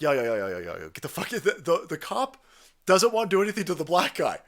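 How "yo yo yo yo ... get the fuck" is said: low, dismissive cop voice; imitating cop. The cop shooing the victim away, lightly voiced. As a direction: wave her off like a lazy cop. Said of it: "the cop is like".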